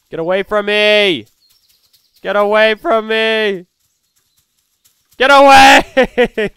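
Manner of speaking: real desperate shouting; frantic yell, building. Real, not acted. Fleeing an attacker.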